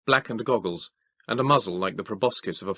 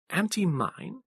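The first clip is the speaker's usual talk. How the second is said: puzzled Moses; 11 semitones higher, slower than usual. A narrator's reading. Moses asks.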